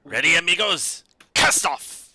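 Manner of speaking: loud, high call to the crew; rousing sea captain's cry. Acted. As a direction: shout the order to cast off. Captain calling crew to sail.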